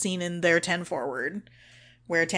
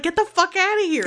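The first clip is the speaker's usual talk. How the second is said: high fast get out; high rushed shoo; shooing him off; 11 semitones higher, 4 dB louder, faster than usual. Retold or imitated.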